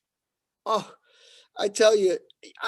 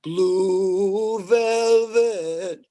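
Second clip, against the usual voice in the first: drawn-out punchline; much slower than usual. Acted. Punchline slowed.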